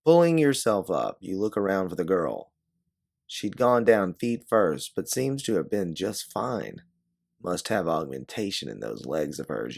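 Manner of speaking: ordinary delivery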